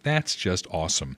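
soft, low deadpan sarcasm; dry, unimpressed aside; sarcastic. Retold or imitated. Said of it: "I'm like".

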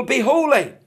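high, forceful pulpit command; loud exhortation. Acted. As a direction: proclaim it loudly at the crowd. Acting out an exhortation to people.

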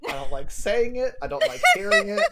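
whiny, escalating complaint; rising exasperation. Real, not acted. Rising protest at the name.

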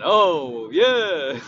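loud booming Kool-Aid man "Oh yes". Retold or imitated. Quitting to the boss, booming Kool-Aid man "Oh yes". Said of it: "like the Kool-Aid man".

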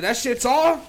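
high, loud disbelieving squeak; surprised disbelief. Retold or imitated. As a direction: act shocked that it's over. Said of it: "I was like".

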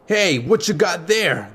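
lightly voiced street boss questioning his thief; gang leader demanding. A narrator's reading. Tall boy to thief holding the ocarina, demanding.

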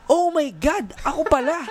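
high, excited gasp of surprise; mock surprise. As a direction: act shocked at your own gift. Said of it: "mabibigla ka pa".

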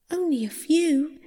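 high, small-voiced plea; pleading. A narrator's reading. Persephone admitting she ate some seeds, pleading. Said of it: "pleaded Persephone".

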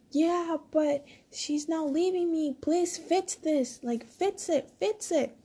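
high, drawn-out pleading; begging. Retold or imitated. Friend begs teller to fix his breakup, pleading. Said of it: "he was just begging".

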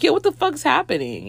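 mock the impatient queue. Impatient party line quote.

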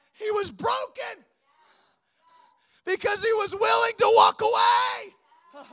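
high, strained preaching cry; impassioned cry. Real, not acted. Preacher on the evangelist's sacrifice, impassioned high cry.

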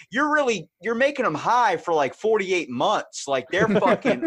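high, loud incredulous argument; incredulous protest. Retold or imitated. Arguing against getting dogs high, incredulous protest. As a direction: argue in loud, high-pitched disbelief.

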